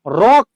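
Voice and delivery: রক stopped dead on the k; one syllable রক, no vowel after k; closed syllable. Shown as a demonstration. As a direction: say রক and let the k block the mouth. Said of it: "আটকে যাচ্ছে".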